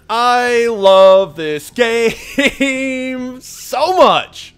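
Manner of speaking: loud, high, hugely drawn-out words; gushing outburst. Real, not acted. Player gushing over his game, loud and drawn out. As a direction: belt it out, stretching each word.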